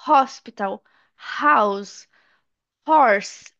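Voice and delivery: "Hospital, house, horse", each with a breathed H; H sounded. Shown as a demonstration. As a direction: separate words, audible H on "Hospital, house, horse". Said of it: "ele não é mudo".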